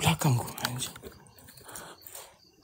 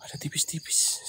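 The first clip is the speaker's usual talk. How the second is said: hushed whisper, barely any voice; whispering about the faint voices behind him; 11 dB quieter than usual. Real, not acted. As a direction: breathe it out so nothing nearby hears.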